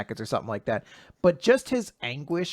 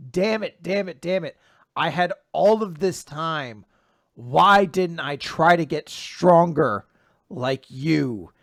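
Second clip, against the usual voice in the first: anguished self-reproach; 2 semitones higher, 7 dB louder, slower than usual. Acted.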